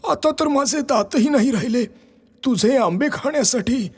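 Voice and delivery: put-on high, thin old man's voice; old Ramu. Aged Ramu asks the tree for a boat, high old voice. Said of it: "Ramu म्हणाला".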